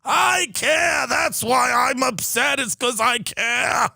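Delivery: a hammed-up tearful hedge fund manager; mock tearful protest. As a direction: whine it like a crying rich man pretending to care. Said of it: "in tears on C N B C".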